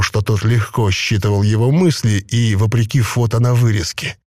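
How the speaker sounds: ordinary delivery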